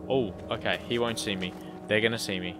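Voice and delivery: ordinary talk, nothing marked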